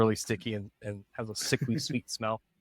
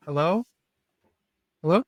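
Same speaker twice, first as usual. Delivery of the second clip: tiny voice; 7 semitones higher, 3 dB louder, faster than usual. Acted. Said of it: "the tiniest little voice go".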